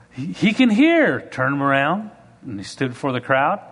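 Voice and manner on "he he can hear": high, drawn-out cry of triumph; re-enacted excitement, pitched right up; excited announcement. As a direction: proclaim the miracle to the crowd. Acted announcement of a healing.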